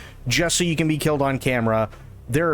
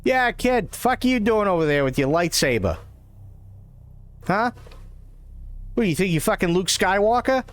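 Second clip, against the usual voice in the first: put-on thick, cocky Boston accent, starting high; put-on Boston street accent; 5 semitones higher than usual. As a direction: heckle like a Boston tough guy. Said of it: "my fucking Boston accent".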